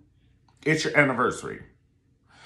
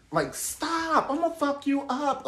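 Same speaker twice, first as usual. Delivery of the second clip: mock threat; slower than usual.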